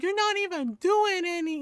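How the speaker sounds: high-pitched replay of a brother's back talk; mock-indignant brother; mouthy teen brother retort. Brother talking back to his mom, high mock indignation. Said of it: "he's like".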